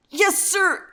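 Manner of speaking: high, strained forced reply; grunted through teeth. A narrator's reading. Hurt son obeys his father, straining through teeth. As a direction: squeeze it out through clenched teeth. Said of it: "managed to grunt through his teeth".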